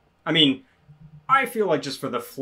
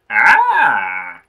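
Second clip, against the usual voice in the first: startled cry; 13 dB louder than usual. Real, not acted. Reacts in game.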